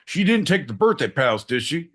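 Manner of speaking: ordinary talk, nothing marked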